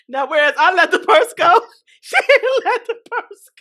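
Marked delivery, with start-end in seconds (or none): 2.0-3.5 high, laughing squeal; words lifted high by real laughter; cracking up. Real, not acted. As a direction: barely get it out for laughing. Cracking up at her own story.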